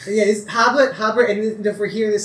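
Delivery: lightly voiced farm boy; young farmhand. GM voicing Tabi NPC.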